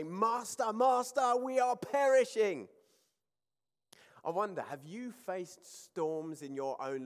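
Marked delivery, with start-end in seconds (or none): 0.0-2.7 higher, louder, desperate pleading; panicked cry. Retold or imitated. Imitating the disciples in the storm calling to Jesus, panicked. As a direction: cry out as if the boat is sinking. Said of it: "we see they say".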